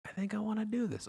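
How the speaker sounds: small, high, soft voice; tentative realisation. Retold or imitated. Acting out a timid realisation.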